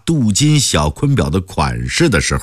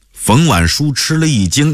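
usual speaking voice

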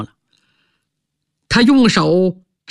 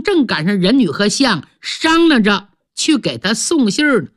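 plain reading voice

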